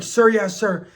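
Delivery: mock recruit answering an officer; recruits' response. Recruits answering yelling officer in chorus.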